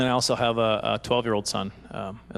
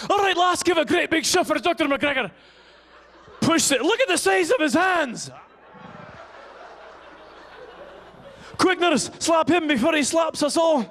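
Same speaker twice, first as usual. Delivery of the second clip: put-on Scottish accent; 12 semitones higher than usual. Scottish delivery-room staff urging on the mother, high Scottish accent. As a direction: yell it in a hammed-up high Scottish brogue. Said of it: "with your Scottish accent".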